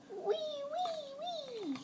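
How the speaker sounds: tiny high squeaky pig wees; soft high piglet wee wee wee; piglet squeal. Acted. Child imitating piglets' squeal.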